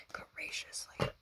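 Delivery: echoing whisper of Graciously. Shown as a demonstration.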